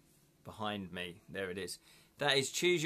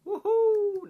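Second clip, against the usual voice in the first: excited cheer; 10 dB louder than usual. Real, not acted. Cheering a viewer's news.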